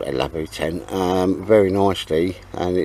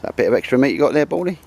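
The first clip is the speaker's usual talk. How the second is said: talking to the dog; 6 semitones higher, 4 dB louder, faster than usual. Real, not acted. Teasing the dog.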